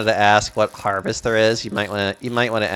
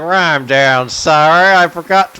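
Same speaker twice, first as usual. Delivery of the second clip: louder sarcastic apology with a laugh breaking in; sarcastic retort; 7 semitones higher, 8 dB louder than usual. Retold or imitated. Mocking people who doubt she's from Texas, in a louder sarcastic retort. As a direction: throw the fake sorry back at them, louder. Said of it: "just be like".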